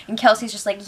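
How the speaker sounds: usual speaking voice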